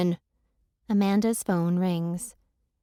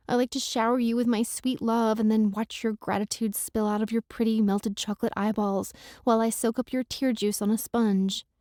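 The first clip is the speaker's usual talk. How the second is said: usual speaking voice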